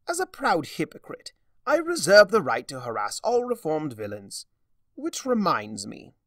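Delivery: high, loud theatrical trickster; a smug, mocking chaos spirit; gleeful showman taunting; Discord voice. A narrator's reading.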